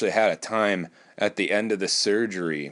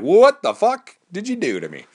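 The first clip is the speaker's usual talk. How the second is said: loud, high, mock-horrified outburst; retold stunned yelp, pitched way up; shocked disbelief; 11 semitones higher, 7 dB louder than usual. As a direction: act out appalled disbelief, voice leaping up. Acts out his shocked reply to surgeon.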